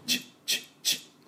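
quiet sizzle-like ch clicks; hissing tch sounds; voiceless sizzle ch. Shown as a demonstration. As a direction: spit out short voiceless ch sounds like grill sizzle. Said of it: "鉄板に落ちた時のチッ".

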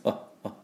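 あ as a tiny, soft blip; barely voiced vowel. Shown as a demonstration. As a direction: let out just a moment of あ. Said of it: "ちょっとだけ出す".

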